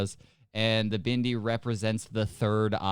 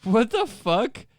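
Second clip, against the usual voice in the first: shocked; 10 semitones higher, 6 dB louder, much slower than usual. Real, not acted. Shocked at a dog photo.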